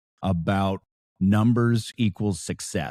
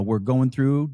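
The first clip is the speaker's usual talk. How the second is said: normal talking voice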